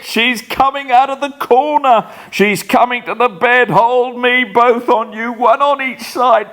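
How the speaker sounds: high, loud panicked shrieks; terrified screaming. Acted. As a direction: scream as the ghost comes closer. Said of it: "screams out".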